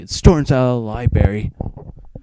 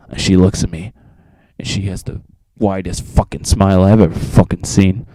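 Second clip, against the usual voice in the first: ordinary talk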